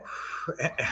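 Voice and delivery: long audible exhale; slow whispered out-breath; steadying breath. Shown as a demonstration. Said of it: "deep breath in".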